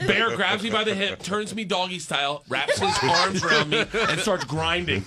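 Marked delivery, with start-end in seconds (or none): none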